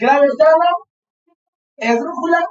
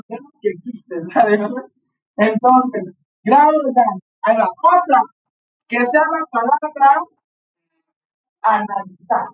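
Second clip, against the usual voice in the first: ordinary delivery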